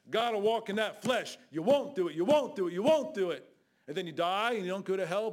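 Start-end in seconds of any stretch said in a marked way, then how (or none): 0.1-1.4 louder repeat. Acted. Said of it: "You gotta walk in that flesh". 1.5-3.5 louder with each "You won't do it"; loud rebuke. Acted. Preacher scolding congregation, repeated.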